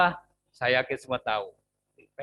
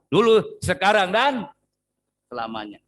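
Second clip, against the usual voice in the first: louder, ringing declaration; proclaiming; 4 semitones higher, 8 dB louder than usual. Real, not acted. Preacher declares God unchanging, loud and ringing. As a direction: proclaim it to the church.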